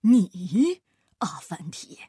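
scornful astrologer, loud first word then dropping low and rough; disdainful. A narrator's reading. Astrologer doubts Afanti is the healer, disdainful. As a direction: sneer at the very idea. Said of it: "星象家不屑地说".

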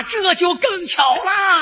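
high, very stretched-out call. Acted. Soldier claiming kinship across the lines, calling out. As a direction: holler it with long drawn vowels.

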